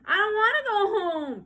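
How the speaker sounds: put-on high, loud whiny little boy; loud high child's protest. A narrator's reading. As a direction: whine that you want to stay. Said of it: "protested Howie".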